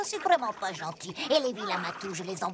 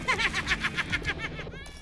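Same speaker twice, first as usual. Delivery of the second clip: evil cackle; 6 semitones higher than usual. Acted. Villain leaves with cat.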